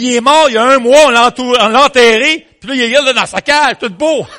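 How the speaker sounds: loud, high, hammed-up hysterical neighbour; crying out. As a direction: blurt it out in mock panic. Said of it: "la voisine crie". (voisine qui crie, affolée, ton comique)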